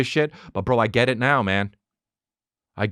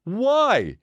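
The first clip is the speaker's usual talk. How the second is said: high, drawn-out question; mock a clueless dad; dopey commercial dad; 11 semitones higher than usual. Dumb dad questions his kid, mock dopey voice. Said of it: "Just with two missing teeth".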